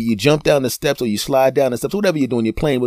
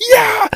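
triumphant yell; 7 dB louder than usual. Acted. Triumphant cry.

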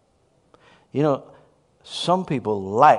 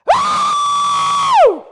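piercing high woo; long, loud, shrieked whoop; screamed whoop; 8 dB louder than usual. Retold or imitated. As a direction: imitate a woman's joyful scream. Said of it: "little woman started screaming".

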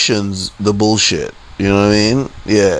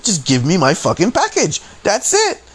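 frustrated demand; 10 semitones higher than usual. Retold or imitated. Customer wanting police to settle it, frustrated demand. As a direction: pitch climbing to a high squeal. Retold angry demand climbing high.

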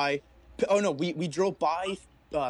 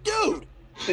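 shocked; slower than usual. Retold or imitated. Storyteller reliving a friend crashing through the window, shocked. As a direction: exclaim in mock alarm.

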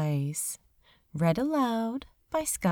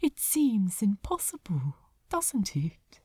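soft breathy whisper; hushed and wistful; murmured; 3 dB quieter than usual. Acted. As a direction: angel whispering to the demon by the water. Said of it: "he murmured aloud".

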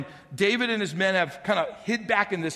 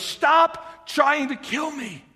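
slow plea; 7 semitones higher, 4 dB louder, much slower than usual. Retold or imitated. David pleads at end.

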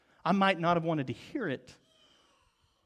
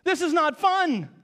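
high, whining protest; whiny child; 8 semitones higher, 3 dB louder than usual. Retold or imitated. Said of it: "are we going".

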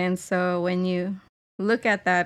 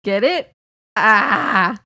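loud mock-eager nudging with a cry; hammed-up prodding; 8 dB louder, slower than usual. Reading own pun text to co-host, hammed-up. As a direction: push the pun on them noisily. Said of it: "And I said".

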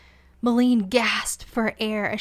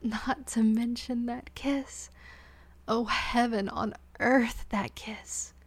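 dreamy whisper; slower than usual. Acted. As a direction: drift off into the memory. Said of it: "Malene whispered almost dreamily".

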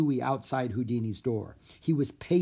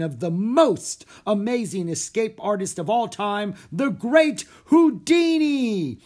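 high, loud announcer build-up, last words stretched out; showman's shout; 8 semitones higher, 7 dB louder, slower than usual. A narrator's reading. Magician introduces the great escape artist to the crowd, shouted. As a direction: build to a huge drawn-out introduction. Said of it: "he shouted".

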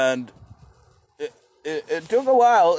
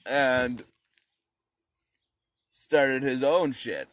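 normal talking voice

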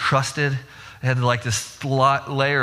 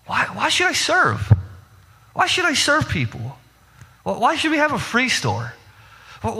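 whiny protest; 7 semitones higher than usual. Retold or imitated. Voicing believers who refuse to serve.

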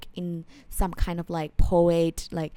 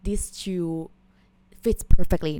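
ordinary delivery

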